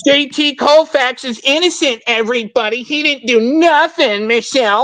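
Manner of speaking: high, put-on mocking voice. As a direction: mimic the opponent's claim in a high sneer. Mocking the other side's claim.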